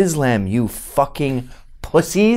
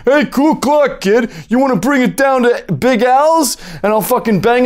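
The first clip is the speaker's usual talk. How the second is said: high, hammed-up presidential caricature; mock president; 6 semitones higher than usual. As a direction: call out a jokey invitation, pitched way up. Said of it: "besides the fact that the president".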